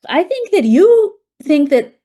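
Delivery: high, loud put-on Spanish accent; Francisco's Spanish accent. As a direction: imitate a charismatic doctor with a thick Spanish accent. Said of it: "he's got a thick Spanish accent".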